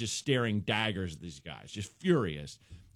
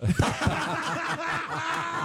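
high cackling laugh. Real, not acted. Comic cracking up at the thought of murder, laughing hard. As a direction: cackle high and hard at your own joke.

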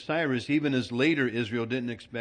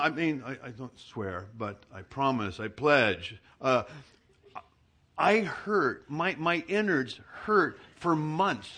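usual speaking voice

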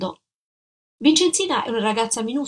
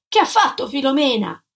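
shouting; 5 semitones higher, 4 dB louder than usual. Acted.

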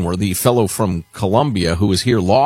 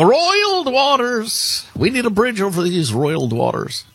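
hammed-up grandiose; 12 semitones higher than usual. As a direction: stretch the words out high with mock grandeur. Hammed-up echo of a grand phrase.

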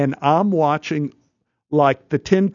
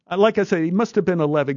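ordinary talk, nothing marked

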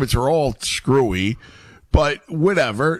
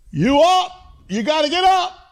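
yelling. Retold or imitated. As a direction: yell it high to get someone out of bed. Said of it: "forgive me if I yell".

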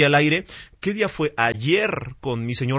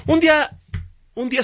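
excited outburst; 12 semitones higher than usual. Real, not acted. Host suddenly exclaims mid-sentence.

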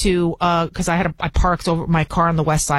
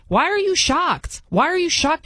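incredulous; 8 semitones higher than usual. Real, not acted. Indignant rhetorical question.

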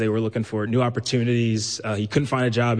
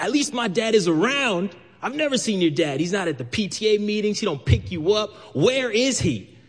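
high mocking schoolyard jab; taunting kid; 9 semitones higher than usual. A school friend mocks the narrator's absent dad, high and jeering. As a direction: needle him like a kid who found a weak spot.

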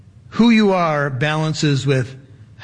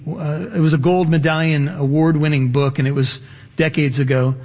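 usual speaking voice

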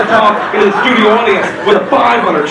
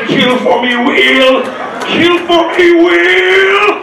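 building from a high call to a higher shouted demand; a game show host turning demonic, rising to a shout; eerie Bob shout; 9 semitones higher, 4 dB louder, much slower than usual. Retold or imitated. Bob commanding the spinning wheel, rising shout. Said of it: "Bob talks really weird when the wheel is spinning".

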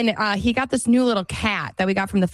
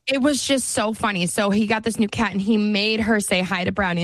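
usual speaking voice

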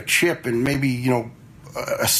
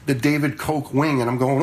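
normal talking voice

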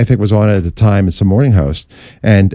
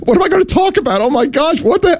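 much higher, panicked squeal of disbelief; a silent scream in your head, pitched way up; inner panic scream. Retold or imitated. Host on air learns there's no guest, panicked high-pitched squeal. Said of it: "In my head, I'm screaming".

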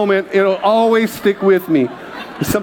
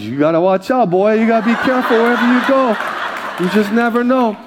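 teasing mom; 4 semitones higher than usual. Retold or imitated. Mom voiced teasing him.

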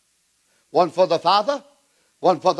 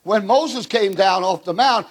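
ordinary talk, nothing marked

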